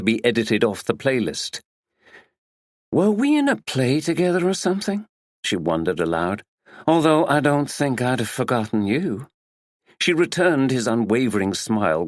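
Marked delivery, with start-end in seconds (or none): 2.9-5.1 higher American actress voice, wondering aloud; American accent. A narrator's reading. Actress Tina to the detective, curious American accent. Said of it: "She spoke in an American accent". 6.9-9.3 a coy American actress compliment; American accent. A narrator's reading. Actress Tina flattering the detective, American accent. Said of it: "She spoke in an American accent".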